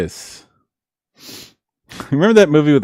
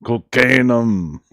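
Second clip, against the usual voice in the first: imitating Arnold; 3 dB louder, slower than usual. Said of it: "he's like".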